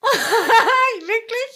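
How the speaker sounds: high, loud burst of laughing disbelief; startled laughter. Real, not acted. Interviewer hearing the child got smacked, startled laughter.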